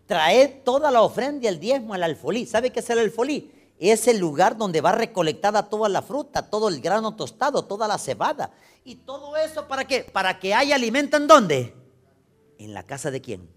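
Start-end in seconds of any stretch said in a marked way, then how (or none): 0.1-11.7 high, loud sermon cry, pushing each phrase; rally the congregation like a preacher at full force; fiery preaching. Acted. Pastor preaching to the church, loud and high.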